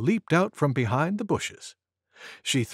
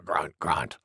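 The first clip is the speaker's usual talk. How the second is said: low, soft grunted words; grunting wild boar; 10 semitones lower, 6 dB quieter than usual. A narrator's reading. Wild Boar after her big sneeze, low soft grunt.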